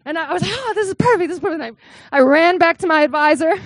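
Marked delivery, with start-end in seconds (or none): 0.5-1.6 higher, thrilled and eager; delighted. Retold or imitated. Student thrilled at her new dissertation topic, higher and eager. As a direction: bounce with joy at the idea.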